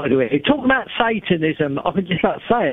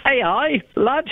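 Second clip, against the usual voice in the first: put-on higher-pitched, jokey Geordie accent; 5 semitones higher, much slower than usual. Caller joking to the host about AI, in a Geordie accent. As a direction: put on a cheeky Geordie lilt. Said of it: "is that Geordie's accent?".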